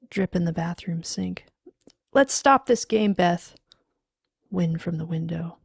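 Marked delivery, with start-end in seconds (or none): 2.1-3.5 higher, louder plea; raised, uneasy voice; anxious protest. Acted. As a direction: call it out with mounting alarm. Pleading into silence, growing alarmed.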